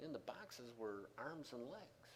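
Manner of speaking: normal talking voice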